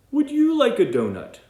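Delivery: high, loud sing-song offer; cheerful panda offer. Acted. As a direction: offer it brightly to the skunk. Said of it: "he says to the skunk".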